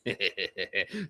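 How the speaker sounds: low, quiet orc chuckle; dark little laugh kept low; chuckle under breath. Acted. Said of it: "chuckles, like, deep under her breath".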